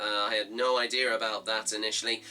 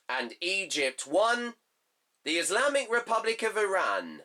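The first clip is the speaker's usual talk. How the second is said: classified-results cadence, rising on home team, falling on away score; football results read; 3 semitones higher, slower than usual. Acted. Vlogger reading the group results to viewers in a results-announcer cadence.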